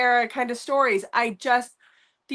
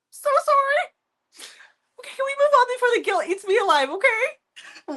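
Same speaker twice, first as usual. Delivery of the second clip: mock guilty; 13 semitones higher than usual. Apologising to friend Tor, mock-guilty high voice. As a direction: ham up the guilt, pleading to move on. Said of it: "Wow, you're feeling guilty?".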